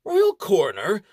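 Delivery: royal coroner rising in disbelief; incredulous question. Acted. Baron doubts the title.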